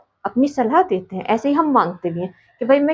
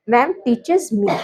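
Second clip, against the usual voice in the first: slow dictation; much slower than usual. Shown as a demonstration. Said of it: "यहाँ पर हम क्या लगाएंगे?".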